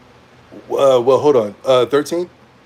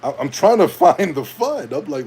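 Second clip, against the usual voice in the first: ordinary talk, nothing marked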